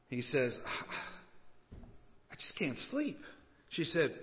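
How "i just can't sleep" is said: soft, slightly raised complaint; sleepless husband. Acted. Sleepless husband answering his wife at three a.m., softly fretful. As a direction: complain quietly in bed at night.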